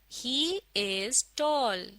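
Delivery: very slow, word by word; slow model reading. Shown as a demonstration. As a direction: read it out slowly for learners to follow. Second model reading for learners. (धीरे-धीरे, एक-एक शब्द पढ़कर)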